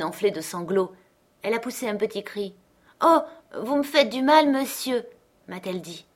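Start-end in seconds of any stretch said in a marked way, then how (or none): 3.0-5.0 put-on little girl's cry. A narrator's reading. Child voiced crying out.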